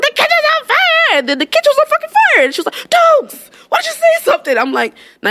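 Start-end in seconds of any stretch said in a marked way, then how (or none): 0.0-2.4 very high imitated panic cry; mock scream. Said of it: "if I would've screamed". 3.2-4.6 very high, wailing mock panic; panicked reproach. Manager's panicked reproach, acted.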